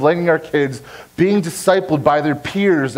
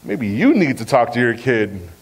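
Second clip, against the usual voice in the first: lower, drawn-down comeback to a parent; pointed retort; 5 semitones lower than usual. Retold or imitated. Youth pastor handing the job back to a parent, pointed retort. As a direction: turn the complaint back on them, pitched low. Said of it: "And I'd be like".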